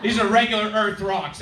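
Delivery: usual speaking voice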